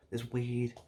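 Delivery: usual speaking voice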